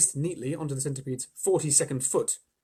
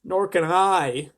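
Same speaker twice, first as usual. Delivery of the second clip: very slow, louder mournful glowworm; wailed; 4 semitones higher, 8 dB louder, much slower than usual. A narrator's reading. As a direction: draw it out in a wail. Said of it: "wailed the glowworm".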